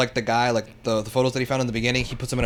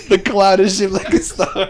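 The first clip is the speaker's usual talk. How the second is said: higher, slow, laughing; 7 semitones higher, 6 dB louder than usual. Real, not acted. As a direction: say it again while cracking up. Laughing repeats quote.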